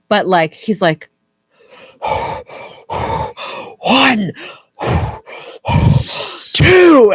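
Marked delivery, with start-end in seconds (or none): 1.7-3.8 imitated angry breathing. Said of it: "breathing and counting". 3.8-7.1 put-on higher, louder huffing count like an angry kid; mock angry counting. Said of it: "making his voice sound like he's angry".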